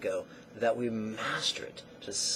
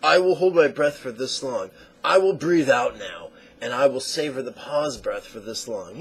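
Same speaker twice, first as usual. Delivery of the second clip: firm, louder commands to your own breath; bossy, louder self-instruction; controlling voice; 8 dB louder than usual. Retold or imitated. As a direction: dictate each breath like a drill sergeant. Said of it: "give into those tendencies and say".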